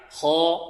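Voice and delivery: خاء heavy, lips not rounded; heavy letter. Shown as a demonstration. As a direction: say it thick and open. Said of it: "انطقوا معي". (تفخيم بدون ضم الشفتين)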